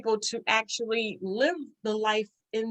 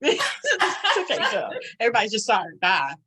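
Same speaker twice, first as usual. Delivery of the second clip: laughing; 3 dB louder than usual. Real, not acted. Laughing off her own quick apologies to the host. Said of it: "laughs at her own story".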